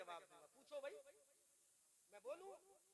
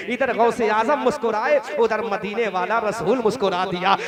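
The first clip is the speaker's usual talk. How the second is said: usual speaking voice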